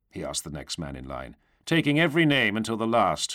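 plain reading voice

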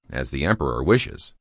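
usual speaking voice

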